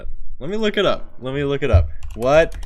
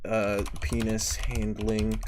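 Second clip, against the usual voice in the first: slow mock threat; 2 semitones lower, slower than usual. Streamer trying a Texan tirade of insults, slow mock threat. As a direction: spit out the insult slowly, with long pauses.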